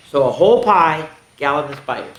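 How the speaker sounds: ordinary delivery, nothing marked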